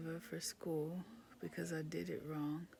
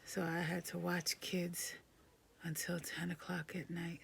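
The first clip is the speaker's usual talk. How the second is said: whispering. Real, not acted. Exhausted whisper.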